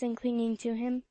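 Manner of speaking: usual speaking voice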